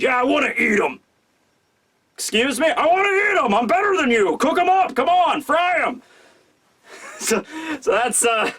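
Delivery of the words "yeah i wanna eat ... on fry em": bully them into cooking it; demanding shout. Retold or imitated. Urashima demanding the diner cook their pet raccoon, shouting high.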